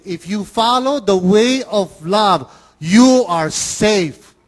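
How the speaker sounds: slow, loud, weighty promise; emphatic. Real, not acted. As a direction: lean hard on every word. Preacher stresses safety in love.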